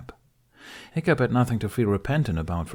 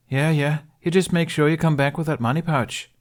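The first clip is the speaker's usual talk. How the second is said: brisk warning, higher and a bit louder; lightly done wary tailor; lightly voiced tailor woman; 8 semitones higher, 4 dB louder than usual. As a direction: wave him off but mind the money. Narrator voices Winifred warning him.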